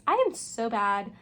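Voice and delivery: usual speaking voice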